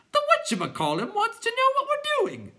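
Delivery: very high squawky clown; clown creature voice. A narrator's reading. Clown informing the other clowns, very high voice. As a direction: pipe it out in a silly high voice.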